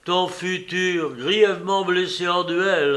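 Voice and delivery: a father blurting bad news, voice unsteady; shaken voice. A narrator's reading. Le père annonce le duel à sa fille, voix altérée. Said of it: "d'une voix altérée".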